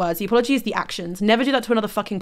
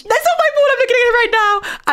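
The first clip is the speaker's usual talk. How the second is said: excited; 6 dB louder than usual. Real, not acted. Excited about shared photo.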